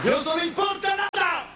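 high ringing exclamation; preacher's outcry. Acted. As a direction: fling it out to the crowd.

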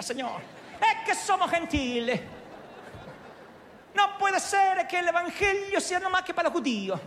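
put-on comic Italian accent, pleading to God. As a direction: pray like a pleading Italian immigrant. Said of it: "oraba a lo tano, a lo italiano".

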